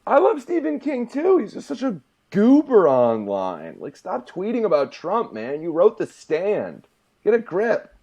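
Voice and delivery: high-pitched, loud exasperation; worked-up fan scolding; exasperated rant. Real, not acted. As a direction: plead in loud, high disbelief. Exasperated rant at an author's tweeting.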